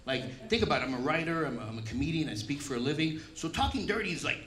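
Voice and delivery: usual speaking voice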